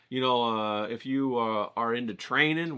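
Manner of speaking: ordinary talk, nothing marked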